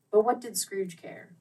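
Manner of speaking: ordinary delivery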